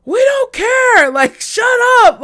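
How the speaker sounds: slow, furious yell; angry shout. Retold or imitated. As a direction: neighbor shouting down. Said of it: "somebody in the buildings was like".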